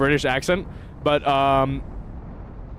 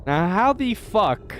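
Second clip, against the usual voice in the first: ordinary talk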